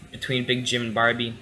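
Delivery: usual speaking voice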